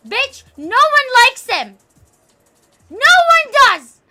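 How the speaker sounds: very loud high yell; angry shout. Retold or imitated. Streamer retells telling off his classmate, very loud angry yell. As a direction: bark it at her. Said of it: "And I was like".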